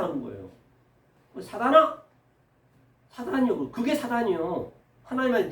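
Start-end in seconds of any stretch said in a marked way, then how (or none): 1.3-2.0 louder, higher mock-indignant question; indignant retort. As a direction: throw it back in acted disbelief. (발끈하며 되묻는 흉내)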